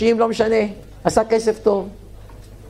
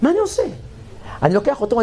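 ordinary talk

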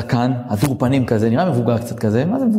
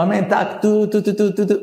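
shofar blast imitation; 7 semitones higher than usual.

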